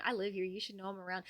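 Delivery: usual speaking voice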